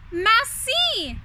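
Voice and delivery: high, loud cheerful call of "Merci"; two servants shout the answer together. Acted. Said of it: "shouted at the same time".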